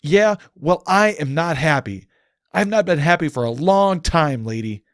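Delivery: angry man rejecting the goddess's hope; bitter shout. Acted. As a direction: throw it back at her, fed up. John snaps back at Celestia.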